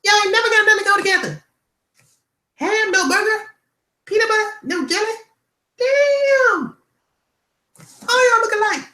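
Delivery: piercing falsetto outburst, long stretched exclamations; Chris Tucker. Acted. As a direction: screech it out at the top of your range. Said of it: "I could do Chris Tucker".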